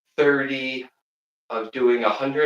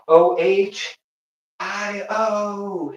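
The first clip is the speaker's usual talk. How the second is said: O H I O spelled letter by letter, rising high; spelled out Ohio; 6 semitones higher, 4 dB louder, much slower than usual. Shown as a demonstration. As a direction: call out O H I O one letter at a time. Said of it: "spelling out Ohio".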